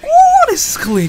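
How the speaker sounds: high, loud burst of hype; excited shout. Real, not acted. Reacting to a slick fight scene, loud and thrilled. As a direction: blurt it out, thrilled by the move.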